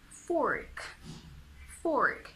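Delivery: FORK in American English with a sounded R; American accent. Shown as a demonstration. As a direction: give FORK a clear American R. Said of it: "The American accent saves you here".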